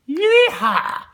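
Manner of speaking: loud high whooping yeehaw; dark side whooping over the finished beers; cowboy yeehaw. Acted.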